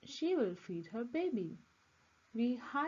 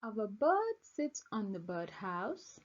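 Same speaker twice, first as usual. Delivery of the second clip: ordinary delivery, nothing marked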